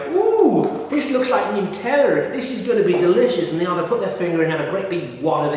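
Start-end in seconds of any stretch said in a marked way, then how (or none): 0.1-3.5 much higher, excited put-on voice, hammed-up; mock eager taster. Vlogger imitates naive foreigners trying Marmite, high gushing mock voice. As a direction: gush as if you expect sweet chocolate spread. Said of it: "they get this stuff and they go".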